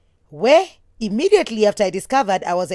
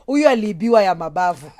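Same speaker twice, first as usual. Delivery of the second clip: usual speaking voice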